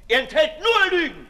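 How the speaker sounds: loud, high contemptuous cry; mocking loud dismissal; scornful outburst. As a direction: spit the accusation out. Sade scorns the appeal.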